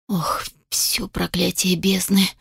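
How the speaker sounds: shocked, slow, breathless whisper; stunned whisper. Acted. Alchemist whispers in shock as his lab is about to blow, whispering. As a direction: whisper it in dismay. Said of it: "прошептал поражённо".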